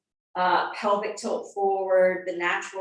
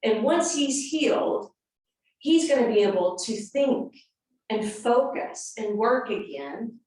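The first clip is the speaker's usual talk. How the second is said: normal talking voice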